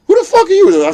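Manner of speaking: very high, loud challenge; acted indignant outburst. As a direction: yell it in exaggerated disbelief. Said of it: "I'm like".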